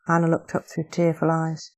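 plain reading voice

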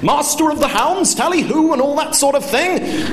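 very high, hammed-up hunting call; boisterous, pompous order across the room; shouting. Father hollers mock hunting talk at waiters, shouting. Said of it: "my father began to shout again".

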